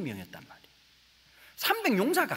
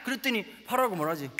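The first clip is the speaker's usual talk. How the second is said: ordinary talk, nothing marked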